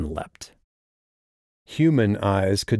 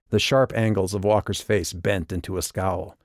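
plain reading voice

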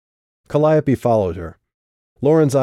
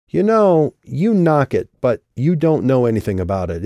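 defensive; 5 semitones higher, 4 dB louder than usual. Acted. Defensive retort to the barb.

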